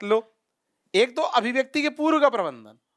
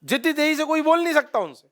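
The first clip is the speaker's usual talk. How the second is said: ordinary talk, nothing marked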